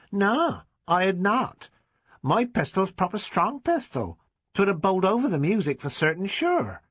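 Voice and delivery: put-on indignant child insisting he's right; stubborn village boy. A narrator's reading. Boy defends his pistol.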